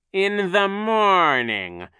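high, loud, very drawn-out; angry insistence. A narrator's reading. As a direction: stretch each word in rising anger. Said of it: "his voice rising in anger".

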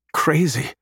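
marvelling. A narrator's reading. Adam marvels at the frog-like animals, marvelling. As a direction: say it in mild amazement. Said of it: "Adam marvels".

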